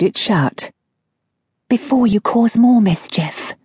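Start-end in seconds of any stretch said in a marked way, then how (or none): 1.7-3.5 higher, a light scold. Acted. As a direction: chide the cat gently. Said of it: "she scolded".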